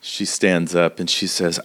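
usual speaking voice

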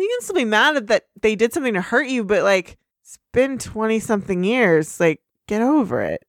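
ordinary talk, nothing marked

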